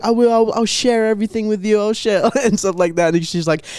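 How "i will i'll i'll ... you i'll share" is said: put-on high eager girl voice; ditzy girl voice. Mimicking the girl promising to share, high voice.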